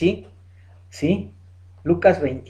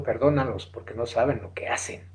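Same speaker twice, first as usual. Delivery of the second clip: quiet, lowered retold plea; hushed plea; 7 semitones lower, 10 dB quieter than usual. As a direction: drop to a low, soft murmur. Jesus's plea voiced in sermon.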